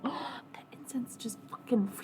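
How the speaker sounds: breathless whisper after a gasp; gasp, then hush it. Real, not acted. Gasps at incense.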